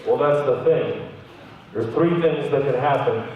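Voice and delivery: low, composed doctor voice; retold doctor. Doctor answering a panicked patient, low and composed.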